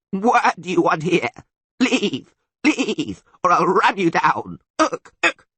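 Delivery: high, loud goat-monster rant; barking monster. A narrator's reading. Monster warns intruders to leave, barking. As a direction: bleat out a threat at a high pitch. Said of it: "barked the monster, stamping his hooves".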